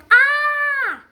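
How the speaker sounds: high, loud startled scream; sudden loud acted cry. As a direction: scream as if something falls on you. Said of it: "Terus kamu teriak".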